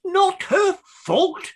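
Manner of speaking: slow, high, scandalized echo; incredulous indignation. Acted. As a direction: throw it back in outraged disbelief. Clerk outraged at the defence.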